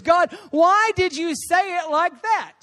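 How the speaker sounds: slow, high-pitched bewildered question; puzzled, stretched-out plea; confused questioning. Retold or imitated. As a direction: ask God in drawn-out bafflement. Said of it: "I was confused".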